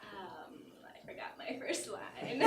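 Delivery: a soft whispered confession to the crowd; whispered aside. Real, not acted. Speaker confides to audience in a whisper. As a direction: breathe it out under your breath, embarrassed. Aside admitting a forgotten line.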